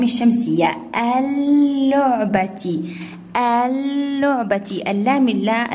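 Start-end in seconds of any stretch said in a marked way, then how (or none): 1.0-2.8 اللعبة slowly with a long held l; doubled lam. Shown as a demonstration. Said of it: "أتى عليه شدة". 3.4-4.8 اللعبة slowly with a long held l; doubled lam. Shown as a demonstration. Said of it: "أتى عليه شدة".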